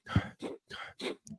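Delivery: sniffing mid-sentence; sniffly stuffed-up cold; sniffle. Real, not acted. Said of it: "I can't stop sniffling".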